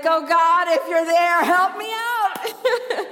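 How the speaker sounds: loud, pleading mock prayer breaking into a laugh; hammed-up desperate prayer on a bumpy flight; panicked plane prayer. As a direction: beg God out loud, half joking. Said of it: "You're like".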